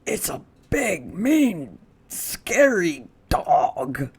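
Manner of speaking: frightened burglar whispering about the dog; scared whisper. Acted. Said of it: "whispered one burglar".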